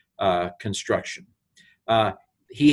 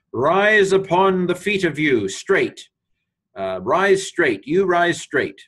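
raised, slow, commanding call; loud command; 8 semitones higher, 4 dB louder, slower than usual. Retold or imitated. Paul calls loudly to the lame man to rise, a raised commanding voice. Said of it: "Paul said it with a great voice".

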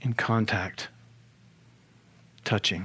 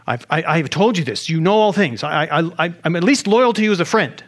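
stammering, higher, indignant protest; upset; 4 semitones higher, 4 dB louder than usual. Acted. Peter misunderstanding Jesus and insisting he is loyal, upset. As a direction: protest your loyalty, tripping over the words. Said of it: "Now he's upset".

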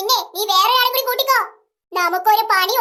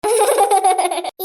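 a low scornful chuckle; madam chuckles at the hired pair; low laugh; 5 semitones lower than usual. Acted. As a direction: laugh down at them.

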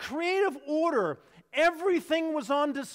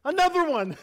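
loud, high joyful disbelief; overjoyed shout of surprise; delighted angels; 6 semitones higher, 7 dB louder than usual. Acted. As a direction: angels cheering at another one. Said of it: "And the angels go".

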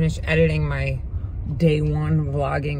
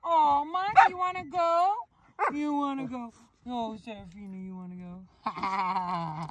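high cooing invitation; dog-talk coo; 4 semitones higher, 6 dB quieter than usual. Real, not acted. Owner coos at Monty and Serafina to play. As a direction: coax the dog sweetly, pitched up.

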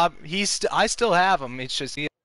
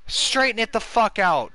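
frustrated yell; 7 semitones higher than usual. Real, not acted. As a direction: yell it out, fed up. Yelling at the batter on screen.

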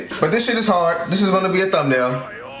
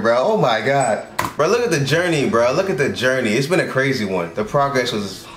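usual speaking voice

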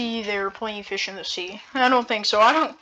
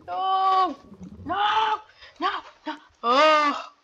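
high desperate shouted no; anguished cries; 8 semitones higher, slower than usual. Pretend. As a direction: cry out in pretend despair. Pretend despair cries.

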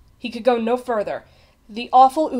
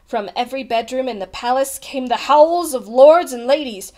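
usual speaking voice

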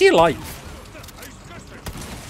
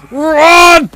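loud, high shouted order; urgent shout; 6 semitones higher, 5 dB louder than usual. Real, not acted. Player urging the character to flee, urgent shout. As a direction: yell it as an urgent command.

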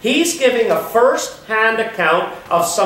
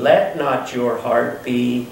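tender comfort; 7 semitones lower, 5 dB quieter than usual. Acted. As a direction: soothe the heart, hushed at the end. Quoting Jesus' comforting words.

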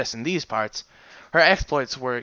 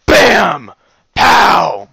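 shouted bam and pow effects; comic-book impact noises; loud punch sounds; 4 semitones higher, 15 dB louder than usual. A narrator's reading.

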